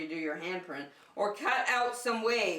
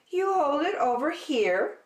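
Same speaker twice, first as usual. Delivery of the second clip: higher helpful zebra voice; Zebra's voice; 7 semitones higher, 6 dB louder than usual. Acted. Zebra showing Bear the guitar, higher and helpful. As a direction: show him kindly, a bit higher.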